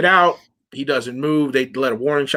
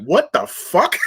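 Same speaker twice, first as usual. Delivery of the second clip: startled; 8 semitones higher, slower than usual. Retold or imitated. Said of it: "And they're like".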